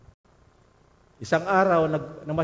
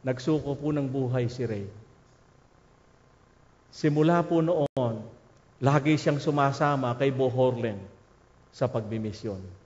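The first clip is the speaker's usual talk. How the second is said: ordinary talk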